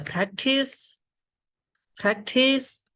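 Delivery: practice drawn out, with a puff on the p; aspirated P. Shown as a demonstration. Teacher giving an example word, aspirated p in practice. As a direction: say practice as phractice, slowly for learners. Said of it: "right pronunciation will be".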